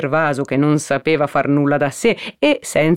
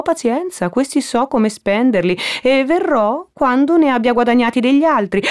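higher-pitched, animated haggling; bargaining visitor; 6 semitones higher than usual. Acted. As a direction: feign indifference to get let in. Toni bargains at the window. (voce acuta, trattando con finta noncuranza)

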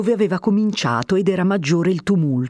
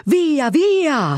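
shouting back; 10 semitones higher than usual. A narrator's reading. Defenders shouting back from inside. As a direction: shove the word at them.

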